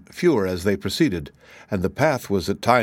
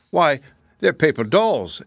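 lightly voiced girl explaining, higher and slower; slightly put-on, higher-pitched answer; Dorothy answering; 5 semitones higher than usual. A narrator's reading. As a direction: explain it slowly, with a lift on the key words. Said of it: "answered Dorothy".

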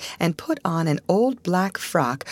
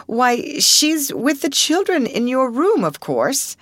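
higher, fluttering and anxious; flustered sister; 8 semitones higher, 3 dB louder than usual. A narrator's reading. Miss Amelia to Miss Minchin, anxious. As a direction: nervously explain.

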